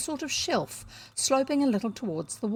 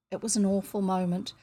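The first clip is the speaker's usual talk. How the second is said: ordinary delivery, nothing marked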